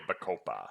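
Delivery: "Bacopa" ending on an open "pah"; ba-koh-PAH pronunciation. Shown as a demonstration. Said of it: "I like saying pa".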